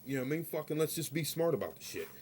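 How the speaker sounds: usual speaking voice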